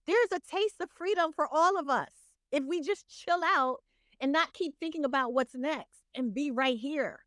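ordinary talk, nothing marked

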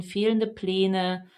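normal talking voice

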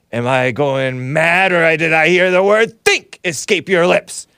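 slow start, then high, loud outrage; a scoffing Sicilian mastermind, incredulous; Sicilian villain voice. Retold or imitated. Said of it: "said that Sicilian".

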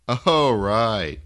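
perking up loudly; brightened. Acted. Brightening at the news.

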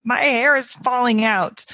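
put-on a touch of a kid overdoing it; exaggerated child. Host imitating a child's exaggerated complaint, lightly voiced. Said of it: "how a child wouldn't just say".

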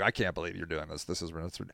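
soft, low, hushed disbelief; a hushed hint of the wife's dismay. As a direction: trail off stunned. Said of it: "my wife is like".

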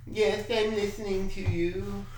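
mock soothing Trudeau, half-hearted; Trudeau impression. Mocking Trudeau to his friend, mild impression. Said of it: "I can't do the Trudeau".

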